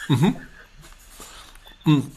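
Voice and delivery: chewing while humming; mouth full. Real, not acted. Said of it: "habe ich jetzt die ganze Zeit das Spritzgebäck gegessen".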